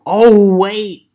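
loud, high "oh" of discovery; sudden realisation. Retold or imitated. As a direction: blurt it as it dawns on you.

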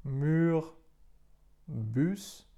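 "mur" and "bus" with a rounded French U; teacher showing French U; French pronunciation. Shown as a demonstration. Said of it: "tak jak w słowach".

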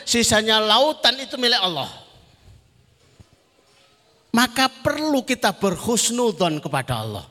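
high-energy religious oratory; forceful preaching. Real, not acted. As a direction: preach it out to the whole crowd.

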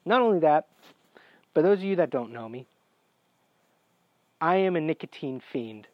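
ordinary talk, nothing marked

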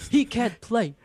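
hammed-up sports pundit rant; imitating Stephen A rant. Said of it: "still my favorite rant".

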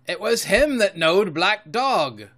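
put-on high, loud, slow old sailor; drawn-out pirate accusation; Morgan, accusing seaman.

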